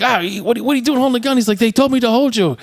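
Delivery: high-pitched mock alarm; alarmed retelling. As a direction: act out a startled question, pitched well up. Retelling a startled movie exchange.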